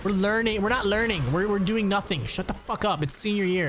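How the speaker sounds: high, mock exasperated shout; mock telling-off; telling him off. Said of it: "I'm like".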